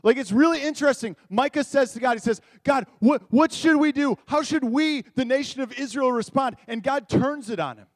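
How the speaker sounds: full-force preaching. Acted. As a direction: preach it out to the whole room.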